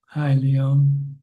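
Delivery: usual speaking voice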